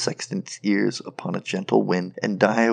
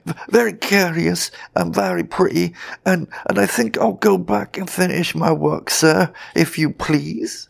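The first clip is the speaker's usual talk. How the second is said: high, louder, stammering plea; terrified sexton; 10 semitones higher, 5 dB louder than usual. A narrator's reading. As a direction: politely beg to get away, scared stiff. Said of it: "half dead with fright".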